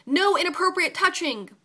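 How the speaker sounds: sharp yell across the room. A narrator's reading. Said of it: "a nurse yells".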